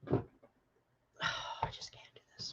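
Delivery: breathless whisper, barely voiced. Real, not acted. Overwhelmed reader whispering to viewers, hushed. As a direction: whisper it as if it's too much to say. Overwhelmed reading child's death.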